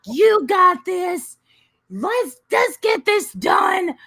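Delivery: high, drawn-out, loud urging; coach's cheering yell. Retold or imitated. Birthing coach in her face, high urging yell.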